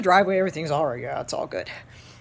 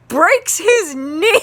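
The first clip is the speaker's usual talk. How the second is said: higher, louder words broken by laughter; laughing while talking; 9 semitones higher, 7 dB louder, slower than usual. Real, not acted. Teacher telling his class about the broken knee, laughing. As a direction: get the words out through laughing.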